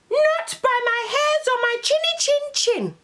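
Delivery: squeaky high little pig refusing; a tiny defiant pig. Acted. First little pig refusing the wolf through the door.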